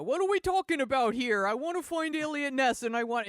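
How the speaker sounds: hammed-up failed gangster boss; bad mobster impression. Shown as a demonstration. Host shows her bad mobster impression, hammed-up and loud. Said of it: "It would sound like this".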